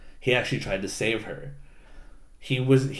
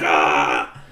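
low, loud berserker growl; berserk roar; 8 semitones lower, 6 dB louder than usual. Retold or imitated. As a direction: roar like the berserker took over. Berserker system takes hold of Allenby.

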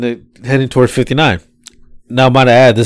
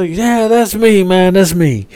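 slow, higher, drawn-out rider voice; rider's voice; 6 semitones higher, slower than usual. Retold or imitated. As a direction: draw it out high and easy. Rider's voice quoted.

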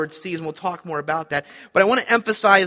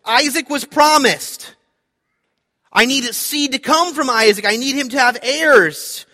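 higher, louder, slow and pleading in disbelief; Abraham's troubled thoughts; 6 semitones higher, 8 dB louder than usual. Retold or imitated. Preacher voicing Abraham's inner protest, higher and louder. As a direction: voice a bewildered father who cannot make sense of it. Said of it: "he probably is thinking".

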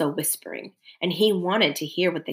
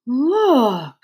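a touch of a dismayed bear; lightly voiced, drawn-out bear call; 3 semitones higher, 4 dB louder than usual. A narrator's reading. Bear alerting the other animals, lightly voiced dismay. Bear's voice in the narrated story.

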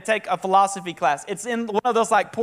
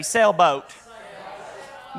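Southern drawl on "Sailboat", vowel stretched; Southern accent; 3 semitones lower, much slower than usual. Shown as a demonstration. Pastor shows the crowd his Southern "Sailboat". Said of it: "like me, like the Southern accent".

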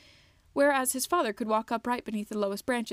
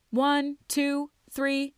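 raised, higher voice counting out as he runs; yelling the count; 4 semitones higher, 4 dB louder, much slower than usual. A narrator's reading. Young troll yelling the count mid-run, raised and higher. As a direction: call each number out loud while sprinting. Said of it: "he yelled out".

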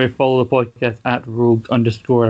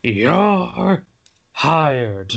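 high, drawn-out boss voice; Vince McMahon; 5 semitones higher than usual. Retold or imitated. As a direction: announce it like a wrestling boss. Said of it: "to paraphrase Vince McMahon".

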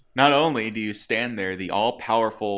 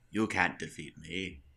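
Hela; 5 semitones lower, 9 dB quieter than usual. Retold or imitated. Acting Hela's taunt to Thor.

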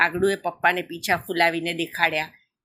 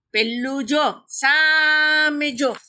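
very slow, stretched higher-pitched call; urging, drawn out; 6 semitones higher, much slower than usual. A narrator's reading. Crow points wife to the road opposite, drawn-out urging. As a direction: stretch the first word right out to point far off.

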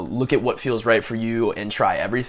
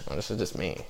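lower, much softer Hader imitation; Bill Hader impression; 4 semitones lower, 13 dB quieter, faster than usual. Repeating the Hader line for the podcast, lightly imitated. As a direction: mumble it like a shy comedian. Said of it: "That's my Bill Hader impression right there".

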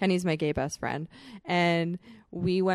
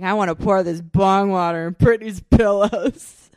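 put-on drunk demand; 11 dB louder than usual. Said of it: "he's like".

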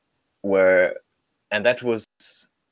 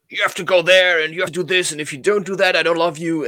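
higher, louder stern parent; demanding parent; 6 semitones higher, 3 dB louder than usual. Retold or imitated. Mock parent ordering a child, higher and louder. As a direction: lay down conditions like a cold parent. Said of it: "they are treated like objects".

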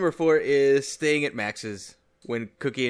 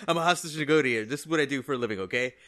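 fast, tough, half-laughing threats; cocky negotiator; 4 semitones higher, 8 dB louder, faster than usual. Retold or imitated. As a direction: bluster like a tough guy. Voicing a bragging hostage negotiator.

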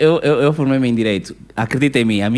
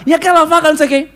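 much higher, quick catty complaint; high mock gossiping girlfriend; 4 dB louder, faster than usual.

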